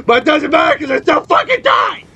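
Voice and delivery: real anger rising into a cry of frustration; pissed off. Real, not acted. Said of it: "Yes, I'm fucking pissed".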